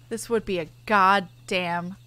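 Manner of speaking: ordinary talk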